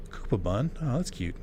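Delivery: normal talking voice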